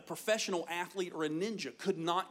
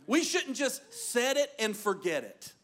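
normal talking voice

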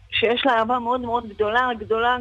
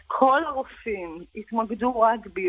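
ordinary delivery

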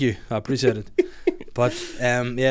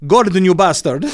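put-on loud, higher Italian-accented outburst; Gino D'Acampo; 6 semitones higher, 10 dB louder than usual. Chef scolding a colleague, loud mock Italian accent. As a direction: cry out in a hammed-up Italian accent. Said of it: "only the Gino D'Acampo voice".

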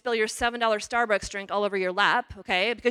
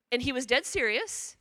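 usual speaking voice

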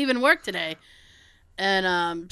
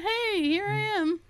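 higher, hammed-up perky ex-coworker; mock visitor; 10 semitones higher, 4 dB quieter than usual. Ex-coworker dropping by the old job, mock perky. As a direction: play the ex-employee popping back in.

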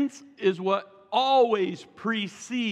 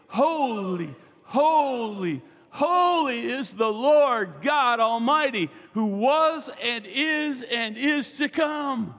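slow, raised preaching cry, building; exalted proclamation; 3 semitones higher, slower than usual. Acted. Preacher voices heavenly creatures' unending praise, in exalted proclamation. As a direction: proclaim it high and drawn out.

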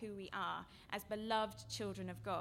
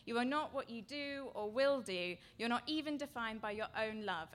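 usual speaking voice

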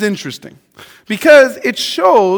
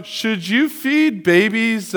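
usual speaking voice

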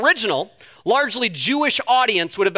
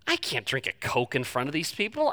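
hushed inner worry; 7 semitones lower, 8 dB quieter than usual. Retold or imitated. Inner thought, afraid to be seen.

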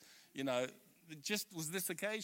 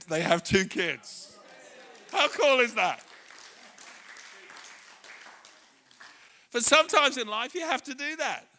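loud, driving sermon climax; preaching at full force; 3 semitones higher, 11 dB louder than usual. Acted. Pastor rousing the church, loud full-force preaching. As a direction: preach it out to the whole hall.

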